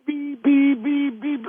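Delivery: high, squeaky imitated alarm; smoke detector beeps. Shoveler acting out his heartbeat as high alarm beeps. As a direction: beep evenly way up high like a detector. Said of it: "sound like a goddamn smoke detector".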